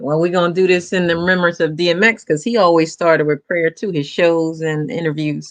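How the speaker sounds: ordinary delivery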